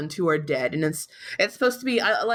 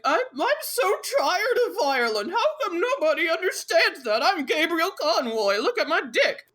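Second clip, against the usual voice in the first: high-pitched hammed-up lament; mock his self-pitying outburst in a much higher voice; mock anguish; 8 semitones higher than usual. Mocks Gabriel's self-pitying rant.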